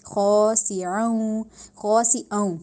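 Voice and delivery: خاسعوں drawn out very slowly, the ending nasalised; slow ghunna demo; slow nasal recitation. Shown as a demonstration. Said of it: "ناک میں لے کے جا کے".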